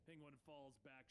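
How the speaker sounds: plain reading voice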